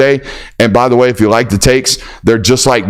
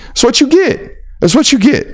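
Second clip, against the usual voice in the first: high taunt; 11 semitones higher than usual. Acted. Taunting catchphrase.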